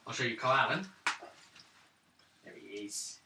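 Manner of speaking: ordinary talk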